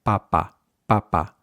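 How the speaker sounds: papa with no puff on either p; unaspirated Spanish papa; muted Spanish p. Shown as a demonstration. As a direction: say papa with the p of spot. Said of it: "more of a muted sound".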